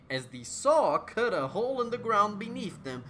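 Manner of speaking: usual speaking voice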